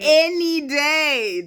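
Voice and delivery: drawn-out, high, loud declaration; emphatic. Real, not acted. Choosing female friends over men, emphatic. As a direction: stretch both words out loudly.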